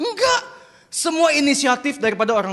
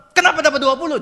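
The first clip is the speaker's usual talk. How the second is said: higher, louder cross parent; raised-pitch mock scolding; sulking angry father; 5 semitones higher, 4 dB louder, faster than usual. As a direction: act out a dad scolding a child over grades. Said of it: "Papa ngambek nggak?".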